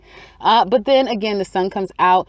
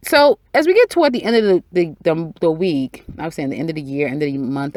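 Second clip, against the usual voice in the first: normal talking voice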